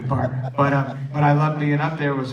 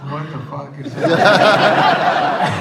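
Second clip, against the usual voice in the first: slow, louder, laughing disbelief; stunned disbelief; 3 semitones higher, 11 dB louder, much slower than usual. Retold or imitated. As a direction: draw it out, amazed and laughing. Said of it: "I'm going".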